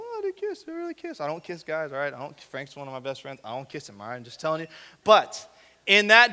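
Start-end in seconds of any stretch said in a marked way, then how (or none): 0.0-1.2 high, soft squeal of mock shock; mock squeamish reaction. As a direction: imitate a scandalized listener in a hushed falsetto. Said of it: "and you're gonna go".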